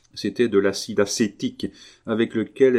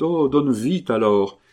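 high, louder, drawn-out urging; eager exclamation; 5 semitones higher, 5 dB louder than usual. A narrator's reading. Woman urging her friend to hand it over, exclaiming. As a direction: demand it eagerly, stretching the words slow. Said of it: "s'écria Carmélite".

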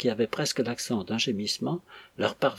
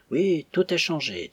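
forced casual; 7 semitones higher than usual. A narrator's reading. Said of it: "d'un ton qu'il s'efforça de rendre aussi dégagé".

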